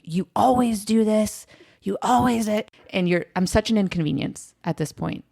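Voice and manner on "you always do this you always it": put-on angry boyfriend.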